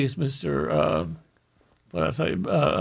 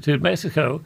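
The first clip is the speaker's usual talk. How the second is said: ordinary talk, nothing marked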